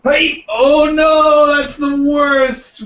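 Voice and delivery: high, loud, drawn-out mock lament; mock dismay. Player hamming up dismay to the game master at being turned to stone, mock wail. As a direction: wail it in hammed-up horror.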